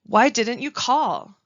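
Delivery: slow demo stress on why. Shown as a demonstration. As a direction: lean on the word why. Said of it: "Each of the words in yellow are emphasized or stressed".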